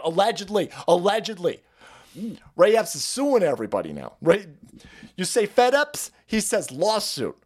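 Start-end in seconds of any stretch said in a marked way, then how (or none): none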